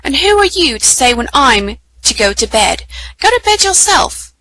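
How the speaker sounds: put-on squabbling child, climbing high at the end; indignant retort. Edmund to Susan over bedtime, indignant retort. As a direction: throw the order back, pitch shooting up.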